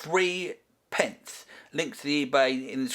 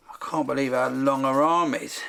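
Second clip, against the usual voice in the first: ordinary talk, nothing marked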